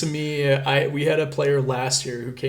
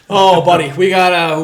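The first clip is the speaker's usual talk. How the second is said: normal talking voice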